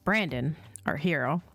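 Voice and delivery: ordinary delivery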